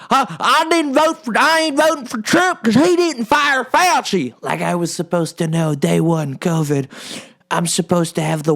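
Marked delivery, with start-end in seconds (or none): 0.1-4.3 high, slow mocking voter voice; squeaky put-on voice of a whiny voter. Mocking the voters who blame him, in a high whiny voice. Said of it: "these people who are like".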